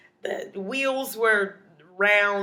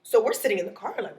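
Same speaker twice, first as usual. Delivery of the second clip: ordinary talk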